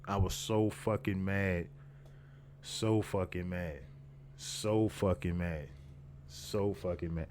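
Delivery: drawn-out words, each repeat slowly spaced; slow, deliberate emphasis. Retold or imitated. Man recalls how mad he was, slow and deliberate. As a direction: stretch it out slowly, letting each repeat land.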